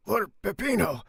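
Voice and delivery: high loud outcry; cry out. Acted. Andrea crying out in disbelief at the pardon. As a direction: shout it in disbelief. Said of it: "cried Andrea".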